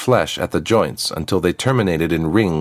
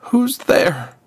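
put-on much higher, torn-out cry of a trapped girl; strained girl voice. Trapped girl cries out to an unseen visitor, strained and high. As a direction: force the words out as if ripped from you. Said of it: "The words came as if ripped from her".

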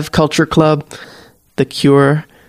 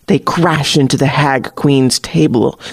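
ordinary delivery, nothing marked

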